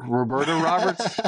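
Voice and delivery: Roberta Roberts? with a rising, disbelieving tune; amused disbelief at own slip; incredulous echo. Real, not acted. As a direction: question your own slip. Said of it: "Oh, I said what?".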